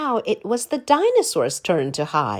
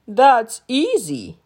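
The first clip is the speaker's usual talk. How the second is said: lightly voiced big friendly dinosaur, slow; easygoing giant; 4 semitones higher, 5 dB louder, slower than usual. A narrator's reading. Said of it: "said the dinosaur".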